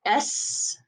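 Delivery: "S" as "ess" with strong final hiss; French "S", long s at the end. Shown as a demonstration. Teacher showing the letter, strong s in "S". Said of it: "very much a strong S".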